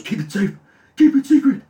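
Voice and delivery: rising to a fierce, high, loud bark; really aggressive. Retold or imitated. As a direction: shove the warning at him, furious. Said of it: "Really aggressively".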